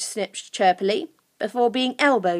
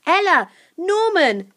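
high, loud call to friends; 9 semitones higher, 6 dB louder, slower than usual. Acted. Lucy remembering her friends, calling out.

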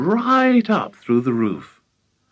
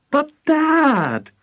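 put-on loud, very high boyish cry; insistent, wailing protest; exasperated plea; 5 dB louder than usual. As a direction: burst out with it, drawn out and high. George's interrupted plea finally bursts out.

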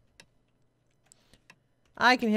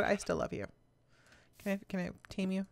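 normal talking voice

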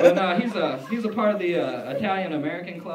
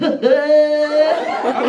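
high, loud mock cackle; hammed-up secretary laugh; imitated secretary's laugh; 10 semitones higher, 13 dB louder than usual. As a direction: imitate her big laugh, high and held. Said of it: "she did this laugh too".

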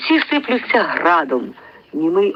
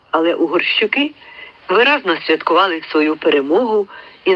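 plain reading voice

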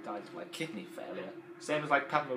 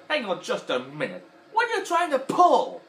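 high, loud suspicious demand; Mister Potato Head voice; 12 semitones higher, 11 dB louder than usual. Retold or imitated. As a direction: challenge him suspiciously. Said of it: "he went like this".

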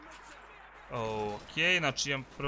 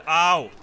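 loud short ouch; pained yelp; 3 semitones higher, 10 dB louder than usual. Real, not acted. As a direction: yelp at the hit. Getting hit in game.

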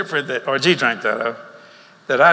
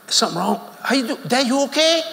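surprised concern; 10 semitones higher than usual. Retold or imitated. Shocked at father's hug.

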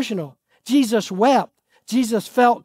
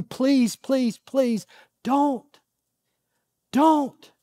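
hushed, earnest begging, softer; soft plea; 4 dB quieter, much slower than usual. Real, not acted. Pastor pleads softly with the congregation not to follow their heart, hushed and earnest. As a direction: gently plead with them to stop.

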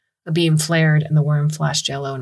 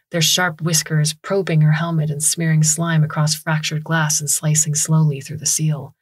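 ordinary delivery, nothing marked

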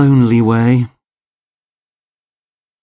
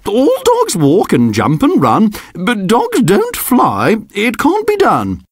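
scolding teacher; 8 semitones higher than usual. A narrator's reading. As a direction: gently scold a fibbing pupil. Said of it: "she said".

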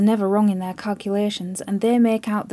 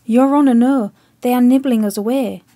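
loud, desperate pleading that keeps climbing; frantic plea; 7 dB louder than usual. Acted. Chips frantically warning the admiral, loud desperate plea. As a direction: shout the warning, pleading for his life.

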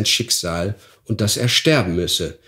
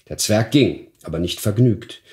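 ordinary delivery

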